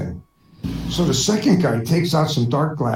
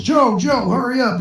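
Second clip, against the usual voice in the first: urgent call; 7 semitones higher than usual. Acted. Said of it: "rushes in and yells".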